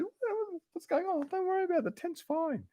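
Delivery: husband mimicking his calm wife in a high voice; wife's voice. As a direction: pitch it way up to play the wife, unbothered. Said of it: "And my wife said".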